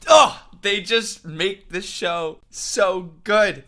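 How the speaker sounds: loud, very high and slow, half laughing; high loud delighted gushing; ecstatic. Real, not acted. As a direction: gush over it at full volume. Overwhelmed by the scene's timing.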